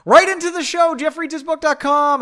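high, loud announcer voice; announcer plug. Acted. Host plugging the show email, booming announcer plug. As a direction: sell it like a radio jingle.

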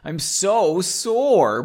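slow, drawn-out mock whining; hammed-up moan of complaint; whiny. A narrator's reading. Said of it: "Margot said like a little bitch".

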